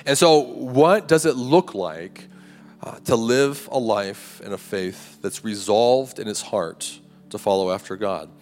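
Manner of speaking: usual speaking voice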